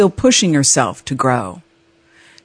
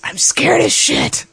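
whispered fear; 12 semitones higher, slower than usual. Retold or imitated. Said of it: "I was like".

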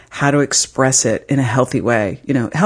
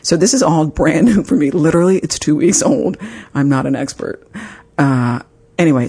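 ordinary delivery

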